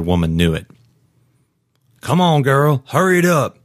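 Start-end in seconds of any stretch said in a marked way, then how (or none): 2.0-3.6 high-pitched, urgent cheering; urging her on. Acted. Security guard urging a woman toward the exit, urgent. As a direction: egg her on in a higher voice. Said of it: "urging Joy on".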